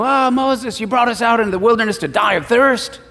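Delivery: high, fast complaining whine; whining people. Retold or imitated. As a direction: moan at Moses like thirsty grumblers. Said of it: "and they go".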